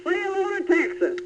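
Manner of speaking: squeaky, slow Texas drawl like Perot; Ross Perot impression. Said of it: "as Ross Perot".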